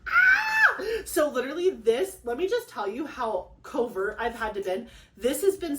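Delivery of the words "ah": very high, loud joyful squeal; excited squeal. Real, not acted. Woman squealing after revealing her pregnancy. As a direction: squeal with delight.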